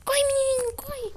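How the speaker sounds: high, frantic urging to run; panicking gamer. Real, not acted. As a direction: yell at him to run. Fleeing the killer in game.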